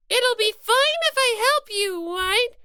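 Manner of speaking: ordinary delivery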